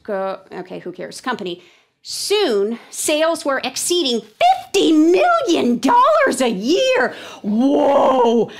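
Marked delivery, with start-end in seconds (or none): 2.0-7.1 loud, high dramatic read-aloud; slow, booming emphasis climbing high. Shown as a demonstration. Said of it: "That was my reading voice". 7.4-8.5 loud amazed exclamation; big, high, drawn-out wow; thinking voice. Shown as a demonstration. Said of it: "that was my thinking voice".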